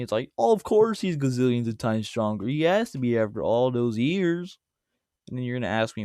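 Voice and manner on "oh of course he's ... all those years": louder, drawn-out mocking outburst; mock yelling. Viewer objecting to his argument, mock yelling. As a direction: imitate an objector yelling in exaggerated protest. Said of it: "you're gonna yell at me".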